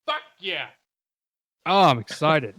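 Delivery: loud happy cheer; excited. Real, not acted. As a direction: cheer it out, thrilled. Sound works, celebrating.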